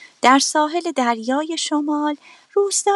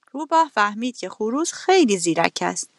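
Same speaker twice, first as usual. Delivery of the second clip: ordinary delivery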